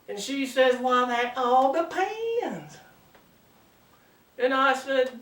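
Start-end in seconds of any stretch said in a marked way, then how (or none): 0.8-2.8 slow, coy Southern belle. Acted. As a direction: draw it out as a teasing Georgia belle. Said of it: "good-looking Southern belle".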